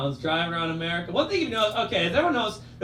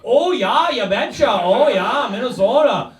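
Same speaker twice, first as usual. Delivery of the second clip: a sing-song, high, loud mocking Minnesota accent; a New Yorker's mocking Minnesota accent, louder and higher; 7 semitones higher, 7 dB louder, slower than usual. Comedian mimics New Yorkers teasing a Minnesotan, loud Minnesota accent. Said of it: "they're always immediately like".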